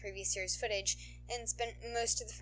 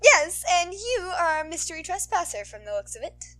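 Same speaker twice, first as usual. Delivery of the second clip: higher, louder, sweet-toned heroine voice; sultry heroine; 10 semitones higher, 13 dB louder than usual.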